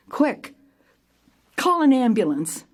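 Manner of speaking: urgent order. Retold or imitated. Director to her assistant after a fall, urgent. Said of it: "I quickly said to my assistant".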